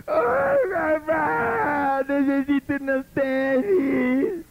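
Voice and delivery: high, slow, crying plea; mock wailing child. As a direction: ham up a child begging like a false prayer. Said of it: "te viniera ... te dijera".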